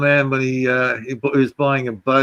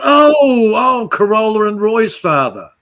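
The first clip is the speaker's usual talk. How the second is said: surprised recognition; 9 semitones higher, 6 dB louder, slower than usual. Retold or imitated. Retold surprised exclamation.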